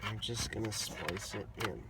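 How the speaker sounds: usual speaking voice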